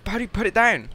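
loud frustrated yell at the game. Real, not acted. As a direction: shout it out in frustration. Yelling at game.

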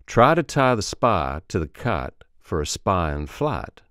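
Alabama accent, every I said as ah; Alabama accent drill. Shown as a demonstration. Coach modelling the shortened ah, Alabama accent. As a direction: drop the y glide from each I, Southern-style. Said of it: "it becomes a shortened ah".

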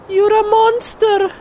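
high put-on woman's cry; horrified girlfriend. [name] shouting at Steve, horrified. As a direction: accuse him like a frightened girlfriend.

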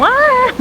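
high sudden yelp; short shocked cry; startled yelp. Real, not acted. Sudden cold or surprise.

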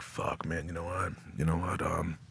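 soft, low musing to himself; low-key muttered resolve; quiet inner thought. Retold or imitated. Quoting his own inner thought.

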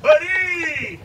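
loud call across the pen. Real, not acted. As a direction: call the gator over from a distance. Calling the gator by name.

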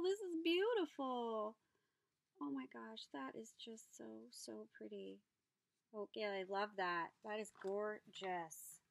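usual speaking voice

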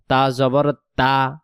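slow তা with a thin, light sound. Shown as a demonstration. Teacher repeating the light তা slowly, thin. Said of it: "তা অক্ষরকে পাতলা আওয়াজে পড়তে হবে".

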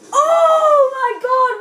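high, loud, drawn-out cry; thrilled scream. Real, not acted. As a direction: stretch it out in a loud amazed shout. Epic kill.

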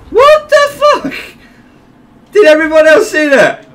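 very high, loud disbelief; shocked squeal. Real, not acted. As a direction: squeal in outraged astonishment. Shocked by death in game.

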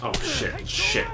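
high startled yelp of panic; real jump-scare shout. Real, not acted. Jumped by enemy in game.

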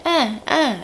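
put-on high urgent toddler grunts; toddler calling. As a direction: call mom over with little grunts. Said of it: "He's like".

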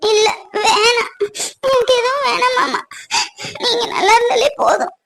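high, tearful plea; crying. Acted. Wife begging husband to stay well, crying. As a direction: cry it out, begging him to be well.

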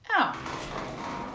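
plain reading voice

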